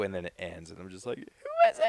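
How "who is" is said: squeaky high outburst of mock surprise. Telling his brother about the trailer ending: squealed high mock surprise. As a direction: yelp it up high like you can't believe it. Reacting to a game trailer cliffhanger.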